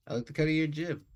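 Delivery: a sly compliment with a grin in it; amused waiter. Acted. Waiter tells the captain he likes his style, playfully.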